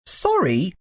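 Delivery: high, questioning exclamation; exclaiming Diggory. A narrator's reading. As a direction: query it in indignant surprise, pitched high. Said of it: "exclaimed Diggory".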